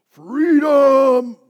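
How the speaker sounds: huge high yell of Freedom!; battle cry shout. Retold or imitated. Preacher shouting Braveheart's battle cry to the congregation. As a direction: bellow it like a war cry. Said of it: "what was the battle cry".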